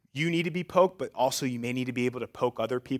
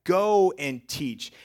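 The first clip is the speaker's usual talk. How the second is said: loud, slow, punching every go; emphatic command; 3 semitones higher, 10 dB louder, much slower than usual. Acted. Preacher voicing Jesus sending the disciples, emphatic and loud. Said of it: "Jesus is pretty emphatic when he says".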